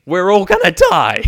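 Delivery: high, loud panicked cry of doom; panicked scream. Retold or imitated. Flight attendant screaming to passengers, loud panicked shriek. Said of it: "you see the flight attendants start screaming".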